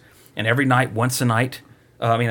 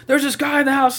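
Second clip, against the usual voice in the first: high mock panic; mock panicked dad; 12 semitones higher than usual. Father finds a stranger in the house, high mock panic. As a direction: squeal it like a frazzled father. Ethan Hawke freaking out.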